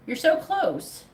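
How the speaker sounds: you're so close, said high and eager; eager push. Real, not acted. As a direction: lift it up to spur her on. Urging a client, repeated twice.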